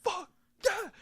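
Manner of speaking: high, softened mock cheer; excited crowd guy. Imagining being the fan at the post-credit scene, excited. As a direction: squeal it like a fan, volume held down. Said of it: "be the guy in the crowd".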